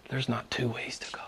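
dropped to a breathy whisper; a whispered climax of the sermon. Acted. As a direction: lean in and whisper the point.

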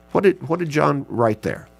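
ordinary talk

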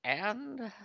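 slow, wavering high alien crone; old lady alien. Acted. Announcing the next letter on request, slow old lady alien voice. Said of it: "as an old lady alien".

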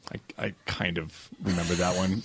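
low, soft, slow quoted admission; hazy recollection. Retold or imitated. Director admits he half remembers the night, hesitant and quiet. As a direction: imitate someone dimly remembering.

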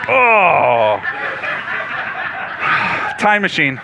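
laughing shout. Real, not acted. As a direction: burst out laughing. Laughs at interruption.

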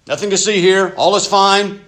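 loud, high, falsely cheerful front; fake composure. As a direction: cover it up with a bright brave face. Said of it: "the worst thing you can do is just to stuff them".

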